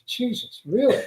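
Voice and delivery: a retold jolt of amazement; stunned disbelief. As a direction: pilot amazed his passenger flew Spitfires. Teller's disbelief at Spitfire pilot.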